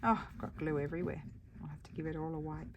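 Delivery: ordinary talk, nothing marked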